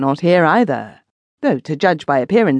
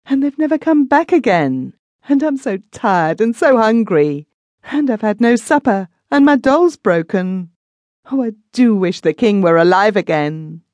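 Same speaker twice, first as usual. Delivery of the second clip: put-on high, weepy little girl pleading; a tired, hungry child crying; sobbing child; 7 semitones higher than usual. A narrator's reading. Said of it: "sobbed the child".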